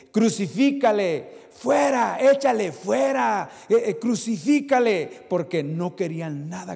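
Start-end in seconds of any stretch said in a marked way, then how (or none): 0.1-5.2 loud, slow mob cry; crowd shouting. Retold or imitated. Preacher voicing the mob demanding crucifixion. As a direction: hurl the words like the angry crowd. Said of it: "aquí le decían".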